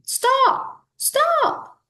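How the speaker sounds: high shouted cry; yelling stop. A narrator's reading. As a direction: call after him at the top of the voice.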